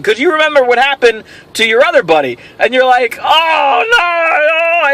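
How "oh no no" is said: higher, shouted mock panic with a cry; hammed-up horrified no; panicked cry. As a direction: wail it like doom just hit. Friend steps on a duck.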